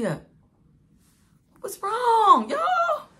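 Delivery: drawn-out, very high, loud cry; exasperated wail. Real, not acted. Mother admitting to followers she went back, exasperated wail. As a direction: wail it in disbelief at yourself.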